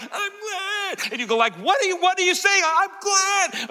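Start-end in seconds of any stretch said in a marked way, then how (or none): 0.0-1.1 far-off high-pitched call, strained through a storm; Jesus. Acted. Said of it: "You will hear the voice of Jesus". 1.5-2.6 high-pitched, frantic yell of disbelief; shouting over a storm. Acted. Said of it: "I can't hear you". 2.7-3.7 high-pitched call across a storm; Jesus. Acted. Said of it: "You will hear the voice of Jesus".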